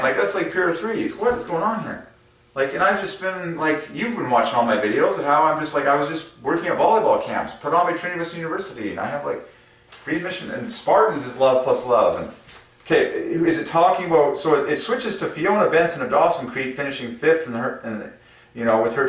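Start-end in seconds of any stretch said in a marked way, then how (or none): none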